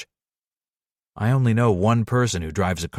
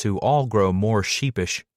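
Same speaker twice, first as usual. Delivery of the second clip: normal talking voice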